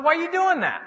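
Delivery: very high-pitched comic question; mock dismay. Preacher repeating his question after a joke, mock dismay. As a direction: ask it high, in playful disbelief.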